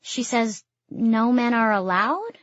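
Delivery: slow, with "allowed" lifting into a question; statement turned question by a rising "allowed"; questioning rise at the end. A narrator's reading. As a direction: let "allowed" climb unsurely, like asking. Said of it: "voice goes up at the end as if in question".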